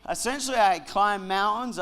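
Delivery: ordinary talk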